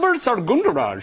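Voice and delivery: put-on Wilbur Soungundaraj in an Indian accent. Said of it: "Do it with an Indian accent".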